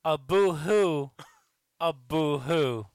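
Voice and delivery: slow mocking boo-hoo; mock their tears slowly; fake crying. Mocking others' upset.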